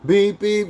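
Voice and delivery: high beep beep like a car horn; imitated car horn. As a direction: mimic a honking horn, high and loud.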